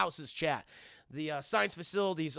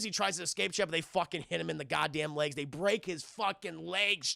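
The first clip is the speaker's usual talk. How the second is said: ordinary delivery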